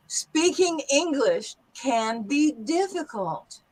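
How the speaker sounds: slow, careful model sentence; teacher's clear demo; slow standard English. Shown as a demonstration. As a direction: say it slowly for students. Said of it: "in a standard English accent, like I'm speaking".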